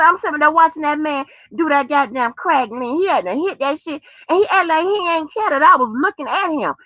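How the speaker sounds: usual speaking voice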